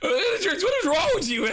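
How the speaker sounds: high, slow, teary laughing whine; laugh-crying. Real, not acted. Streamer asking chat what's wrong, laugh-crying. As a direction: whine it while laughing to tears.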